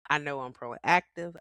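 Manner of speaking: ordinary talk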